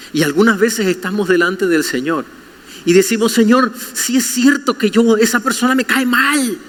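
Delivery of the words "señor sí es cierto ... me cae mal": high, mock-whiny complaint to God; a lightly played sulky believer; whiny prayer. Believer complaining to God about someone, mock whiny.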